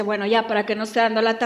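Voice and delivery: quick, low grudging concession; giving in, resigned. Acted. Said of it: "Y entonces Jesús dice".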